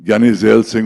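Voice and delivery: ordinary delivery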